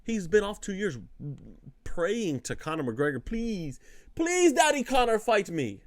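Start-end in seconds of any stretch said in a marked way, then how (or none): 3.2-5.8 pleading in a hammed-up Irish lilt, pitch jumping high; mock Irish accent. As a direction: beg like a desperate fan in a put-on Irish accent. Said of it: "got the Irish accent".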